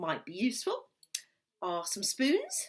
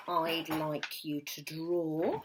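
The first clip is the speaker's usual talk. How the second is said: usual speaking voice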